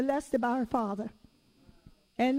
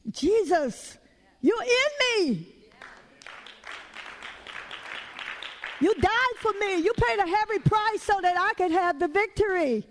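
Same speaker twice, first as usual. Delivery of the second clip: fervent declaration; 4 semitones higher than usual. Pretend. Believer lifting herself up, telling Jesus with rising fervor. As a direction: proclaim it like you mean it.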